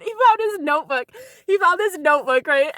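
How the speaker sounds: high, loud and thrilled; giddy storytelling; excited telling. Retold or imitated. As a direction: tell it like big news. Excitedly retelling boyfriend's find.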